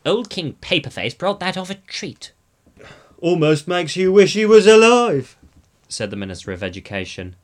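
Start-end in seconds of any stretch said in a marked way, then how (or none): 0.1-2.3 put-on a touch of hearty old sailor; lightly voiced navy man. A narrator's reading. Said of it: "who used to be in the Navy". 3.2-5.4 loud, high character voice; Minister of Education voice. A narrator's reading.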